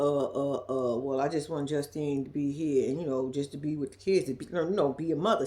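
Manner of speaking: low, soft, halting mock reply; hesitant excuse-maker. Michael fumbling to explain why she should quit, hesitant. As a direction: lightly imitate a man fumbling for an answer.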